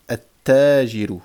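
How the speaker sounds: slow, loud "at-tajiru" with double t and no L; doubled first letter. Shown as a demonstration. Teacher repeating the shadda example, doubled t. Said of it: "on va doubler, on va mettre une shadda".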